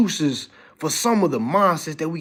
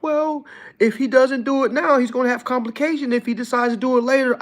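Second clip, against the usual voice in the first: mocking doctor; 8 semitones higher than usual. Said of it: "They tried to say".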